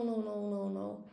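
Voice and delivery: ordinary delivery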